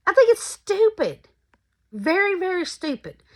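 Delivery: high, exasperated scorn. Real, not acted. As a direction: scoff at the idea. Exasperated opinion, pitch jump.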